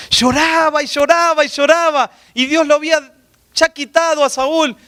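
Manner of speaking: high-pitched, insistent preaching; predicación enérgica. Real, not acted. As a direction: preach it with rising urgency in a sustained high pitch. Preaches at full force. (prédica enérgica en tono agudo)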